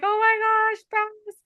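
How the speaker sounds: high, emotional retold exclamation to the pastor; a retold tearful-joy outburst, voice high; overwhelmed oh my gosh. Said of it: "I'm like".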